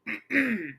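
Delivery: high little throat clear. A narrator's reading. Pinky prepares to ask her question, clearing her throat. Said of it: "she clears her throat".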